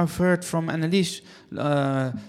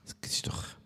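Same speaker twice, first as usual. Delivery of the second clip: whispering; 12 dB quieter than usual. Real, not acted. Line drops, muttered aside.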